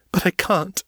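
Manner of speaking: high, breaking sob; sobbing. A narrator's reading. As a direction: let the voice crack into a sob. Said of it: "She sobbed".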